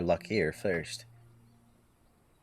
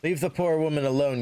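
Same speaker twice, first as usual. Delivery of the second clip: higher, louder call to leave her be; defiant bystander shout; 7 semitones higher, 4 dB louder than usual. Acted. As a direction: stand up to the bully out loud. Bystander defends woman.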